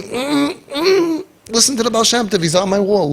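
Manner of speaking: high, tearful plea breaking into a laugh; Hashem, crying out. Acted. God pleading with His children, high and tearful. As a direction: cry out like a pained Father, then laugh. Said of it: "And Hashem is saying".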